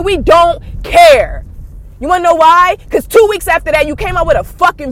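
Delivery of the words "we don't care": very slow, loud, spaced-out words; drawn-out exasperated shout; emphatic dismissal. Real, not acted. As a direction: stretch out each word, landing hard on care.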